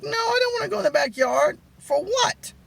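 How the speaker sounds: very high, squealed mock refusal; high-pitched protest. Storyteller refuses the guy's backyard invitation, high mock-alarmed squeal.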